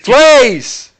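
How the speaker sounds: loud high shouted plea; shouting. Acted. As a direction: yell it to be heard. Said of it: "Just shout louder".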